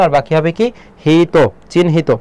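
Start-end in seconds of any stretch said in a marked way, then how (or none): 1.0-2.1 চিহ্নিত said slowly as 'chin-hito'; থেমে ভেঙে উচ্চারণ. Shown as a demonstration. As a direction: stop after chin in চিহ্নিত, then hito. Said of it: "এখানে থেমেছে এই জন্য হর্ষ চিহ্ন". (চিহ্নিত ধীরে 'চিন্-হিতো')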